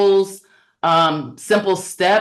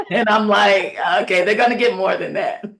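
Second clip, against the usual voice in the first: talking through laughter; amused, laugh running through the words; 3 semitones higher than usual. Real, not acted. Director reacting to parents' vague answer, laughing.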